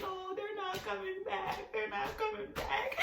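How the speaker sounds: high, slow, soft wail; despairing whine, dragged out; tweaking out. Retold or imitated. Panicking to boyfriend that the ambulance left, tweaking out. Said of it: "I'm just tweaking out".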